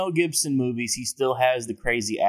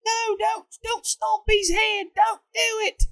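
high, pleading mock scream; imitated woman shouting; slower than usual. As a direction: shriek it like a frantic woman. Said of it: "a woman shouting".

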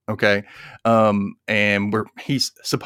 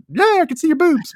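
much higher and louder, hammed-up; mock fan squeal; 4 dB louder than usual. Joking about [name] nearby, mock high squeal. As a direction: squeal it like a goofy starstruck fan.

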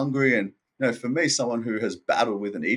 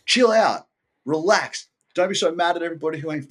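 self-scolding; 6 semitones higher, 4 dB louder than usual. Retold or imitated. Scolding himself in his head.

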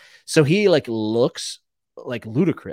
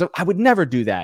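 high, fast, innocent protest; mock innocent; 8 semitones higher, 7 dB louder, faster than usual. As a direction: play it falsely innocent. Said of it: "Tyrion says".

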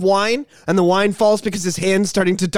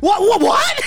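rising to a high laughing shout; high laughing yelp of shock; shocked what; 12 semitones higher, slower than usual. Retold or imitated. Caller stunned by dead dad.

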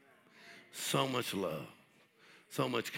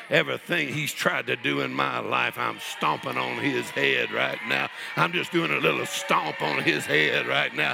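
rising into loud, high-pitched preaching; full-force preaching; 7 semitones higher, 10 dB louder than usual. Real, not acted. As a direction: build to a fervent shout at the congregation.

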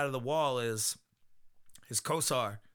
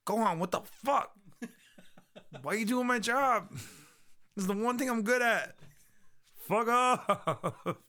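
high-pitched whiny protest; indignant Krillin; 8 semitones higher, slower than usual. Acted. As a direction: complain in a raised, mock-outraged voice. Voices Krillin complaining to Gohan.

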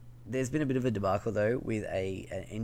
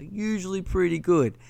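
sarcastic; 10 semitones higher, 7 dB louder than usual. Acted. Sarcastic repeat of the quote.

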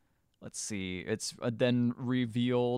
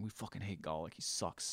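muttering prisoner; 4 semitones lower, 12 dB quieter than usual. Retold or imitated. Prisoners complaining about Golic.

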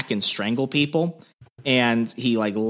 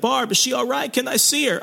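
put-on high-pitched, worried young man voice; hammed-up anxious boyfriend; John Travolta; 13 semitones higher than usual. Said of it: "I imagine Danny catches, like, a John Travolta early".

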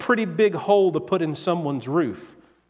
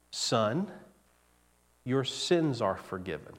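softer, lower, gentle pronouncement; Jesus softly forgiving the paralyzed man, tender; 6 semitones lower, 8 dB quieter than usual. Acted. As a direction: speak it quietly, like a kind father to a son.

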